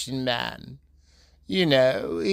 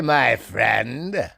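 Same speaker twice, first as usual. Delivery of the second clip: ordinary delivery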